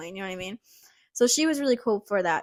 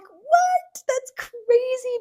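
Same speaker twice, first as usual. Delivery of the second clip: squealed, far higher disbelieving "What?"; shocked disbelief; much slower than usual. Retold or imitated. Hearing she got hired, squealed shocked disbelief. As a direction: shriek it out in happy shock, then drag "crazy" slowly. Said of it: "I was just really surprised".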